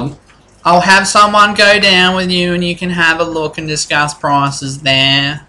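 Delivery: a fussy clerk who finds everything filthy; lightly voiced old clerk.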